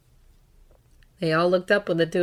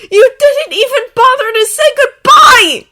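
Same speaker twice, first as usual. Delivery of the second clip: slow, high, very loud accusation; anguished shout; 17 dB louder than usual. A narrator's reading. Draco blaming Harry for leaving, anguished shout. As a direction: shout it with all the pain. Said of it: "Draco shouted".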